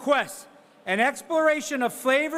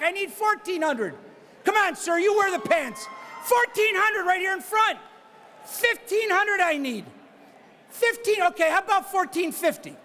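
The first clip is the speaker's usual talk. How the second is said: brisk auctioneer patter pushing for the next bid; auctioneer bid calling; 6 semitones higher than usual. Real, not acted. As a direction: call the bids like a charity auctioneer. Auctioneer running live bidding.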